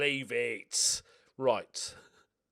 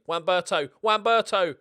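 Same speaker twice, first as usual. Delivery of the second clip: loud name calls climbing higher; excited cheering; 6 semitones higher, 15 dB louder than usual. Real, not acted. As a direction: urge the player on, louder each time. Cheering a player through on attack.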